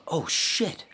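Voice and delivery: high-pitched amazed exclamation; retold sudden realization. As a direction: let it leap up in disbelief.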